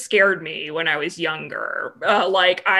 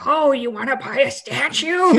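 creepy shopkeeper voice; 5 semitones higher than usual. Retold or imitated. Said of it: "he had that creepy voice".

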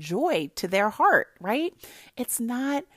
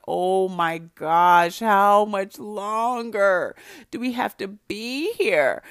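louder, drawn-out complaint; mock bored whine; 5 dB louder, slower than usual. Stuck starving at a car show, whining about the wait. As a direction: moan like the car show never ends.

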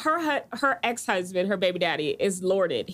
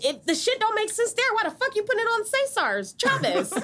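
exasperated laughing rant; 9 semitones higher than usual. Real, not acted.

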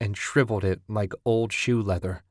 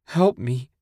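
crying; 4 semitones higher than usual. A narrator's reading. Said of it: "she cried".

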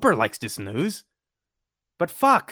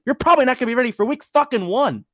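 exasperated; 9 semitones higher, 4 dB louder, faster than usual. Real, not acted. Angry rant climax.

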